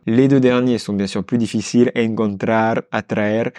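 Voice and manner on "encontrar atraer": "Encontrar", "atraer" with rolled r. Shown as a demonstration. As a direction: roll every r. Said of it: "on a deux R dedans".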